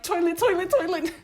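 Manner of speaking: high and loud, panicked chant; urgent pleading. Retold or imitated. Desperate rush to bathroom.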